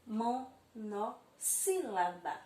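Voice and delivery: monossílaba stretched out slowly, syllable by syllable; slow syllable demo. Shown as a demonstration. Teacher to pupils, naming the term: slow, drawn-out monossílaba. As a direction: spell out monossílaba slowly for the kids. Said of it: "ela é chamada de".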